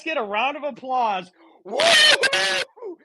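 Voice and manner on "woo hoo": mock cheer.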